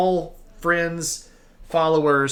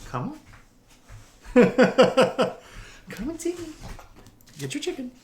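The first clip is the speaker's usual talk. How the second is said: much higher, softer pet talk; talking to a dog; 3 semitones higher, 4 dB quieter, faster than usual. Real, not acted.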